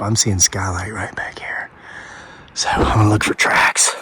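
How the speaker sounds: soft unvoiced whisper; whisper, trying not to be heard. Real, not acted. Whispering near the summit.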